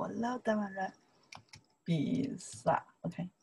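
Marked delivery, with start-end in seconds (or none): none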